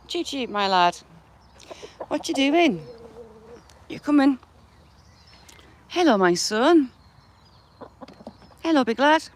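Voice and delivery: pet talk. Real, not acted. Cooing at the chickens again.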